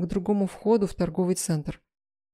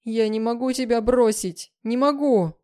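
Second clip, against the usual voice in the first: high, desperate protest; pleading, distraught cry; anguished wail; 5 semitones higher, 4 dB louder than usual. A narrator's reading. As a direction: wail it, pitch climbing on the refusal. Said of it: "завыла я".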